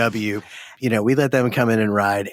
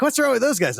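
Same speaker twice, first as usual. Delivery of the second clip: puzzled rider; 11 semitones higher than usual. Retold or imitated. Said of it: "And, and they're like".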